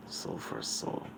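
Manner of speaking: whispered echo of a voice; faint, breath-only repeat. Acted. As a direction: whisper it very softly, like an echo inside someone's head. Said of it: "deep booming voice echoes throughout Deku's head".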